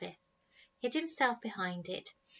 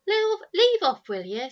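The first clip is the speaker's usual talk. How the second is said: angry shout; 12 semitones higher, 12 dB louder than usual. Acted. Eldest Snargett boy yelling at his brother to stop. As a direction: shout him down hard.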